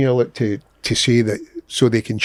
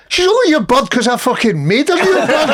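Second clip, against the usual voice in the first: indignant; 9 semitones higher than usual. Retold or imitated. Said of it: "I was like".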